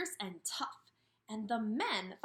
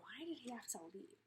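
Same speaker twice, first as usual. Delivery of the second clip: whisper; 13 dB quieter, faster than usual. Real, not acted. Teacher whispers her question to the class, hushed.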